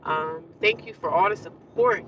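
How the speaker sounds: normal talking voice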